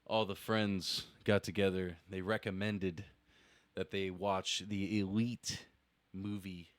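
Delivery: low, measured, solemn storytelling; poetry recital. Acted. Recites a piece of open poetry.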